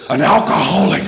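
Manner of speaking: loud affronted announcement; blurted-out indignation; outraged. Retold or imitated. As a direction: act scandalized by the insult. Outraged report of the insult.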